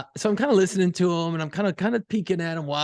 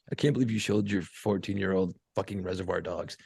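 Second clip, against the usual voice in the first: low, muted mock reproach; imitating disapproval; 10 semitones lower, 5 dB quieter than usual. Imagined people judging dad for showing the film, low disapproval. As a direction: voice a critic in a much lower tone.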